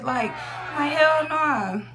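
loud, high hell no; emphatic shout. Real, not acted. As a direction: shout it in disbelief. Outraged at five-dollar sponges.

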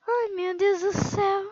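scared gasp. Real, not acted. Gamer girl nearly falling, gasping in fright. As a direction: startle and drag out the plea.

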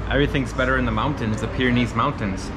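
ordinary talk, nothing marked

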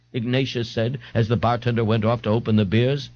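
plain reading voice

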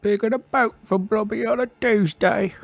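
high squeaky blob creature voice; Blobby voice. Pretend. Friend voicing Blobby in a high silly voice.

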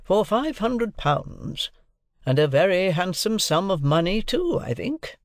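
high, drawn-out savouring of the sum; lawyer's repetition. A narrator's reading. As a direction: repeat the amount with a lawyer's relish. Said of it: "repeated Mister Jaggers".

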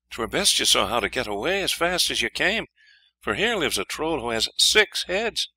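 high, earnest princess voice. A narrator's reading. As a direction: warn him off in a high voice. Second princess warns hero.

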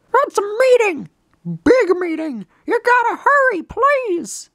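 very high, pleading cartoon voice; urgent game messenger. Messenger hurrying the hero to a meeting, high and urgent. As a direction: plead in a squeaky character voice.

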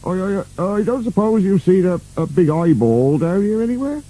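stammering high-pitched timid question; nervous. A narrator's reading. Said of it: "said Burke nervously".

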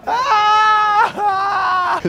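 long, very high mock scream; hammed-up pain wail. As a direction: cry out in exaggerated agony. Wailing after play fight.

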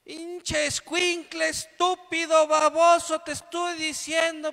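mock screaming enraged mother; furious mother yelling. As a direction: explode at the child like a mother who snapped. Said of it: "se transforma totalmente".

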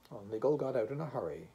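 usual speaking voice

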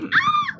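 high answering shriek; startled scream. Acted. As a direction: scream back in fright. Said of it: "I'm like".

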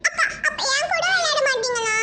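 high, wailing protest; crying child. Acted. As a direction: bawl the question out like a left-out child. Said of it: "ரெண்டு இப்படி அழுதுட்டு இருக்குங்க".

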